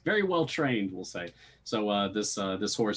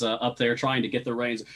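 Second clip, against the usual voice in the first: ordinary talk, nothing marked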